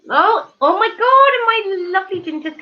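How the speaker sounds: thrilled to see someone join; squealing with joy; delighted surprise. Real, not acted. Spots a friend arriving.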